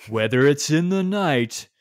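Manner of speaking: slow, mock-grand trailer narrator; drawn-out, overdone storybook narration; narrator impression. Co-host mimics the game's terrible narrator, mock-dramatic. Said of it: "here's my impression".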